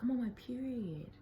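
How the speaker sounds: acted-out annoyed excuse; retold annoyance. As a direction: protest it, mildly exasperated. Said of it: "I was pissed".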